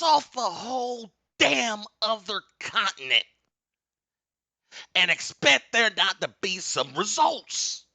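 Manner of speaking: very high, loud shouted preaching; booming preaching. Real, not acted. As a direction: build it to a shouting climax. Preaching rant climax.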